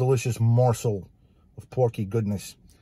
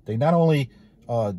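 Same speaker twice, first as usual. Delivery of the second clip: usual speaking voice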